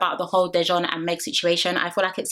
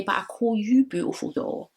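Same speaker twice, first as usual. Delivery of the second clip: imitating Dejon; 2 semitones higher than usual. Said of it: "Dejon would just say".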